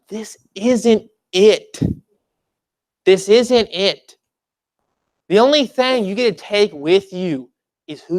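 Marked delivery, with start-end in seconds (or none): 0.1-1.8 slow emphatic. Acted. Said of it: "repeated slow for emphasis". 3.0-4.2 slow emphatic. Acted. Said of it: "repeated slow for emphasis".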